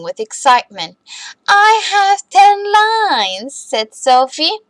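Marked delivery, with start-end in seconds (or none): 1.5-3.5 put-on higher, slow, proud child voice; proud little girl. Sophie boasting to her classmates, high and slow. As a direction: show off your ten lines, drawn out.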